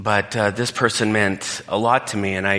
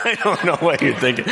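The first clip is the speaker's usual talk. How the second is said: laughing; 8 semitones higher, faster than usual. Real, not acted. Laughing through the line.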